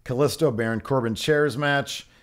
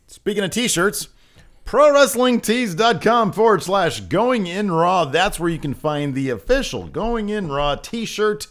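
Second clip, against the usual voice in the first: slow, higher promo voice; ad read; 10 semitones higher, 6 dB louder, slower than usual. Acted. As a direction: sell it like an announcer. Shirt plug read.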